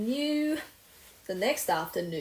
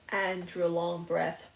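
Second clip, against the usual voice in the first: usual speaking voice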